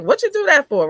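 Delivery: high, loud indignant yelp; groggy husband's protest. Retold or imitated. Husband woken by water on his face, yelping high and loud. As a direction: play the husband jolted awake by water, indignant. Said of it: "he was like".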